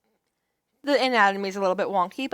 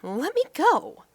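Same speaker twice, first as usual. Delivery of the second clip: raised heroine voice, frightened; 7 semitones higher, slower than usual. Acted. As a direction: beg him to stop.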